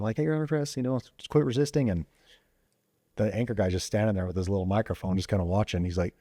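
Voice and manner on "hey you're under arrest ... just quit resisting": smiling arrest. Retold or imitated. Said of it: "I kinda smile while I'm doing it".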